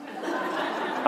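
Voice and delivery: short breathy real laugh; a quick laugh at his own punchline; chuckling along with the congregation. Real, not acted. Preacher laughs at own joke.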